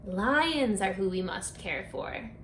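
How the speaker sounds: plain reading voice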